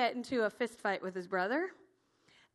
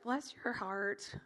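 slow, lower, softer pitying line; patronizing sympathy; 4 semitones lower, 6 dB quieter, slower than usual. Acted. Saul to young David volunteering to fight, patronizing. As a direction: humour him gently, drawn out.

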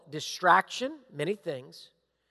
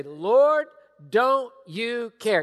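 slow, loud pleading Lord don't you care; Martha's complaint; 3 semitones higher, 5 dB louder, much slower than usual. Retold or imitated. Martha pleading with Jesus, slow and loud. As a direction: drag it out like a wronged sister.

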